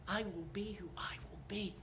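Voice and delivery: soft unvoiced whisper; whispered divine name. God whispering his name to Moses. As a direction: breathe the words out as God's hush.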